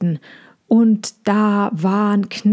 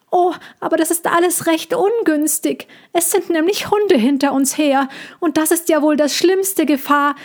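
high-pitched cat's lament; mewling cat; 6 semitones higher than usual. A narrator's reading. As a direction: mewl your complaint in a high voice. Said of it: "und maunzte". (hoch maunzende Katzenstimme)

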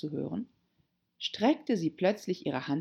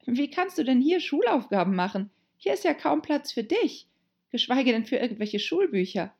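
high, chiding heroine voice; self-scolding Alice; 8 semitones higher than usual. Acted. Alice scolding herself about schoolwork, high and chiding. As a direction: answer yourself in a high, reproving tone.

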